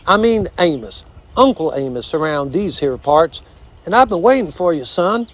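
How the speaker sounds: high southern gentleman armadillo; flowery, folksy southern critter voice; courtly armadillo. Acted. Armadillo introduces himself.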